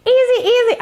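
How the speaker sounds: high, mocking echo of easy. To people who overuse easy, mocking sing-song. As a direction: toss the word off like a cliché. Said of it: "people banter about easy".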